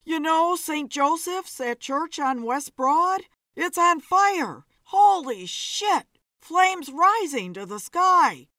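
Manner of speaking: high, loud, excited news; urgent alarm. A narrator's reading. Man bursts in reporting a fire.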